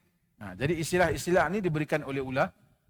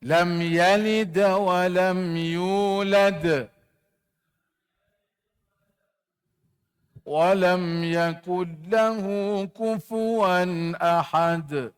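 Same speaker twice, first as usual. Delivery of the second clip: slow chanted tajwid recitation; Quran recitation; much slower than usual. Shown as a demonstration. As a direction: chant it slowly like a Quran teacher. Leads class Quran recitation. (bacaan Quran berlagu perlahan)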